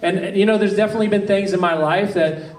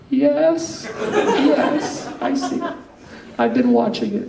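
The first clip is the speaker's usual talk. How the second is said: imitating a nervous wife, much higher with a shaky laugh; mock nervous, half-laughing, pitched up; 8 semitones higher, slower than usual. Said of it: "a very nervous and, uh, very quiet".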